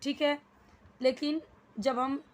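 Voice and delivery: ordinary delivery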